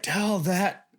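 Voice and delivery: high, drawn-out mock holler; irritated pilot's yell. Pilot hurrying a lagging skydiver, retold irritated yell. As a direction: imitate a pissed-off pilot yelling back. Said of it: "he yells back, and he's in a pissy mood".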